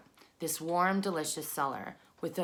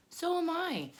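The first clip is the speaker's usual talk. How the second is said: high little spider voice; tiny voice; 12 semitones higher than usual. Acted. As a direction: pipe it up high. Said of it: "said a third voice".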